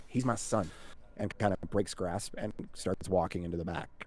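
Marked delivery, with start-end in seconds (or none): none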